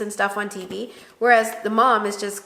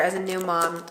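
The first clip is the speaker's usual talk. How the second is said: ordinary delivery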